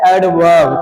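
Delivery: एडवर्ब said slowly and clearly as ed-verb, low falling; teacher models एडवर्ब for the class; model pronunciation. Shown as a demonstration. Pronouncing एडवर्ब for students to copy. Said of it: "तो इसकी pronunciation होती है".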